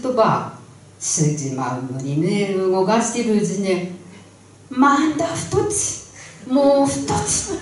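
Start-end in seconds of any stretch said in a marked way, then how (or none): 4.7-7.5 high, giggling count; giddy counting. Acted. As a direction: count them off with glee. Bride counting cakes. (笑いながら高い声で数える嫁)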